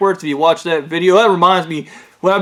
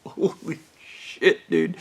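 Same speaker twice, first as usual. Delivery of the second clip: slow, voiceless whisper of awe; shaken whisper; 6 semitones lower, 8 dB quieter, slower than usual. Real, not acted. Still spooked by the memory of the ritual, whispered. Shaken after telling the scary story.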